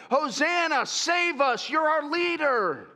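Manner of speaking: high, loud Hosanna cry; rousing acclaim; crowd shout. Acted. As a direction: shout it to the arriving king.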